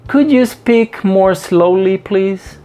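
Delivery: slow, deliberate English for learners; slow model phrase. Shown as a demonstration. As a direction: spell the request out at half speed. Teacher models request for slower speech.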